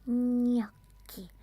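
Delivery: usual speaking voice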